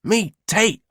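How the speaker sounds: high eager call, naming himself. A narrator's reading. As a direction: call out brightly. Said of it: "he calls".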